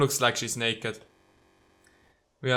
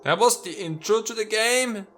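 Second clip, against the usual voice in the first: surprised; 9 semitones higher, 4 dB louder than usual. Real, not acted. Reacting to game intro.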